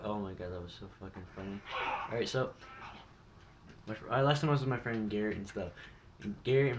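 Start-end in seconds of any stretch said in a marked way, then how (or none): none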